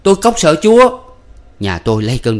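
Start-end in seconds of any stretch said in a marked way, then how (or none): 0.0-1.0 much higher, louder defiant shriek; wild scream. A narrator's reading. Husband screaming defiance at God, wild shout. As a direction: scream it out in a frenzy. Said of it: "Tôi thét một tiếng hãi hùng".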